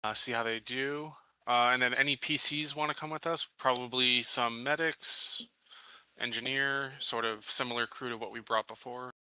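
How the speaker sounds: ordinary delivery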